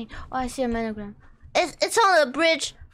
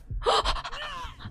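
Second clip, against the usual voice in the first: panicked gasp; 10 semitones higher, 8 dB quieter than usual. Real, not acted. Dying in game, panicked.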